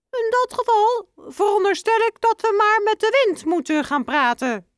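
very high, squeaky little mouse voice; high mouse voice ending in a piep; squeaky father mouse. Acted.

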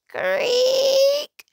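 very high drawn-out squeak; squeaky creak. A narrator's reading. Voices squeaky armor creak.

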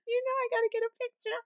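laughing squeal. Real, not acted. Repeats it, laughing.

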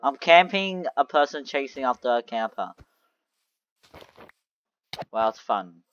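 ordinary talk, nothing marked

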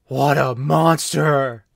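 slow outraged gasp; drawn-out horrified judgement; mock outraged. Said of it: "they're like".

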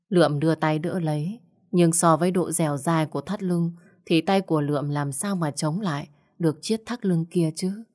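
plain reading voice

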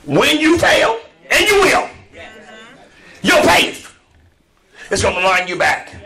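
high, loud proclamation; preaching shout. Real, not acted. Preacher proclaiming to the congregation. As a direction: drive it home to the church.

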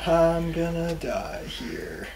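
slow drawn-out mock despair; mock dread. Friend picturing doom, slowly. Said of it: "I'd be like".

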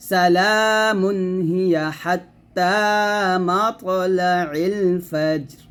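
very slow melodic chant in one breath; tajweed recitation, long held vowels; Quranic recitation. Shown as a demonstration. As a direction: recite it drawn out, joining the phrases. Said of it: "এরকম মিলাতে হবে".

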